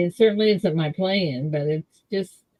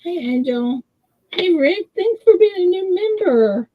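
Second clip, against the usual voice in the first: high, bright welcome; excited greeting; 11 semitones higher, 4 dB louder than usual. Real, not acted. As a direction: greet him warmly and high. Greeting new member on stream.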